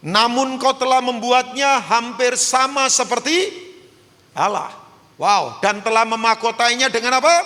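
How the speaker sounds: loud, high sermon delivery; full-force preaching. Real, not acted. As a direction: proclaim it to the whole congregation.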